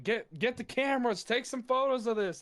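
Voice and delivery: high, thrilled call for cameras; retelling his own excited shout in the booth. Retold or imitated. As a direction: hype it like you can't believe it. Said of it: "I was saying".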